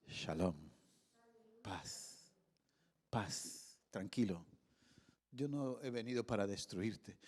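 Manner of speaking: más bajo y más suave, sereno y tranquilizador; háblale en paz, con calma, como quien consuela; voz de paz. Acted. El Señor habla paz a Gedeón. (el Señor calma al temeroso Gedeón, suave y sereno)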